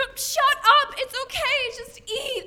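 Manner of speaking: high, loud, exasperated outburst; flustered snapping. Acted. Girl hiding a skeleton from family, high flustered snapping. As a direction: shut them up in a panic.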